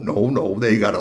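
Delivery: usual speaking voice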